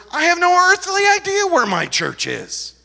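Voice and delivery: very high, loud exclamation; mock bafflement. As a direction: proclaim ignorance with comic exaggeration. Acts pastor's exaggerated bafflement.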